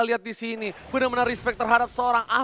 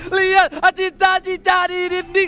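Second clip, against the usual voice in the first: excited; 6 semitones higher, 4 dB louder than usual. Real, not acted. Hero escapes, caster hypes it.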